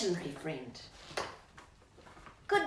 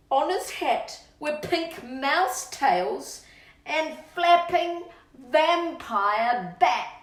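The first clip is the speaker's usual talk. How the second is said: bouncy rhyming read-aloud, louder on the gross bits; reading to children; 6 semitones higher, 7 dB louder, slower than usual. Acted. Reading the birthday verse to Rocky, bouncy and relishing. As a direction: savour every disgusting detail for the child.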